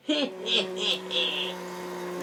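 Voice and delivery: gloating villain cackle; evil laugh. Acted. Bunny snatches hero away, gloating evil laugh. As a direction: cackle over the trap. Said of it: "His evil laugh once again".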